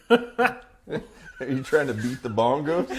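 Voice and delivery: ordinary talk, nothing marked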